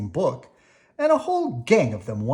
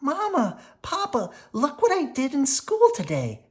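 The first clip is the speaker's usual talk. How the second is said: put-on high, bright-eyed rush of a thrilled child; excited child; 14 semitones higher, 4 dB louder than usual. Sister showing her painting to her parents, excited and high. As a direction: burst out with the day's news. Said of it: "Sister was the excited one".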